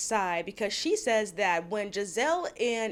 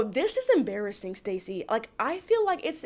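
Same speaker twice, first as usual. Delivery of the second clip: ordinary talk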